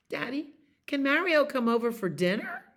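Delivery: a hint of a begging child; eager child. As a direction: ask Daddy like an excited kid. Child's line read from book.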